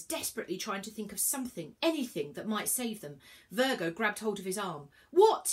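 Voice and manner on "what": loud, high, rising cry; sharp high-pitched startled question; cried out. A narrator's reading. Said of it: "he cried".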